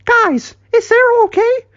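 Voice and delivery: very high-pitched worried voice; alarmed squeak. Acted. Michael asking about choking Cyril, alarmed squeak. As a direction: squeal with concern.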